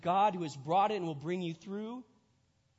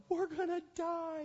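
hammed-up frightened plea; mock panic; 13 semitones higher than usual. Disciples waking Jesus, in mock panic. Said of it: "They wake Jesus up like".

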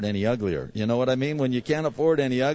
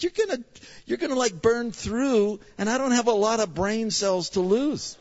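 high-pitched mock panic; alarmed protest; 11 semitones higher than usual. Acted alarmed protest to the doctor.

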